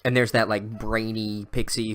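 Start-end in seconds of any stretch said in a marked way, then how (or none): none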